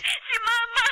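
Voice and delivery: tearful panic. Acted. Panicked call from the hospital.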